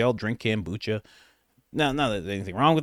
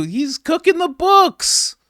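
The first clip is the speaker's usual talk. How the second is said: high, slow mocking squeal of cooking the books; mock guest phrase; 13 semitones higher, 5 dB louder, slower than usual. Reactor parodying the guest, high slow squeal. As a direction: draw out books in a shrill parody.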